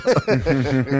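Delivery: a short burst of real laughter; laughs. Real, not acted. Telling the host about the band, laughing. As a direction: laugh out at the memory. Laughing at his own story.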